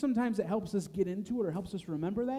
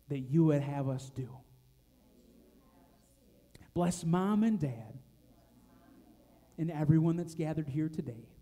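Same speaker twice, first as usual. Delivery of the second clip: put-on children's prayer; 3 semitones lower than usual. Leader praying line by line for kids to repeat. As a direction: pause so the kids can echo.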